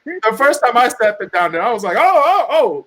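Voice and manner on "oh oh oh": startled. Retold or imitated. First time sitting at glass.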